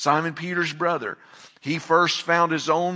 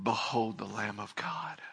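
hushed, reverent proclamation, much softer; awed hush; 6 semitones lower, 11 dB quieter than usual. Pretend. Preacher hushes the congregation with awe, reverent whisper. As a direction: breathe it out in awe.

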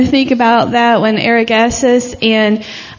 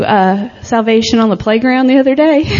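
ordinary talk

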